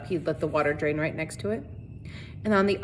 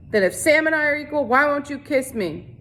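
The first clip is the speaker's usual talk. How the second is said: mocking leer; 7 semitones higher than usual. A narrator's reading. Sheriff mocking equality.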